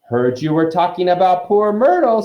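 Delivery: high, loud mischievous taunt; teasing poltergeist. A narrator's reading. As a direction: needle them with mock sympathy. Peeves teases Hermione.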